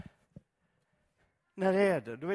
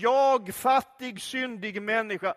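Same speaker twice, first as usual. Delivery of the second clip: louder, hammed-up liturgical recitation; mock confession; 3 semitones higher, 8 dB louder than usual. Congregation reciting the Sunday confession, mock solemn. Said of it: "läser vi syndabekännelsen tillsammans".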